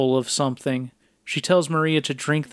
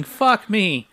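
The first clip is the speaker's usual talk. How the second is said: higher-pitched, lightly imitated yell; mild mock yell; 6 semitones higher than usual. Co-host recaps Maria's line in the film, mild mock yell. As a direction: retell her yell half-heartedly, pitched up. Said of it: "Maria is yelling".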